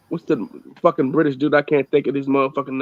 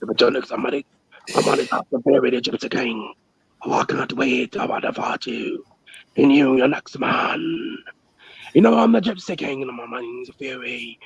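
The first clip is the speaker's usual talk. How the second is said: put-on low, gruff British accent, slowing down; British boxer impression; 4 semitones lower than usual. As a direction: growl it like a cocky British heavyweight. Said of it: "I can do more than one British accent".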